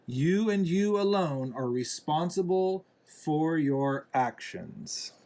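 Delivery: usual speaking voice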